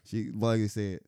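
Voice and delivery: ordinary talk, nothing marked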